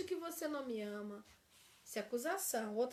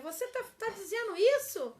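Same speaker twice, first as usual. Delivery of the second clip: sharp accusing question, higher and louder; 7 semitones higher, 7 dB louder than usual. Shown as a demonstration. Teacher demos an accusing partner, sharp and louder. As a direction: throw it at them like a charge. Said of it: "Ao invés de dizer".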